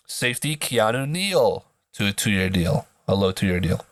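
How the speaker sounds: ordinary talk, nothing marked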